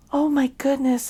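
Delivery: usual speaking voice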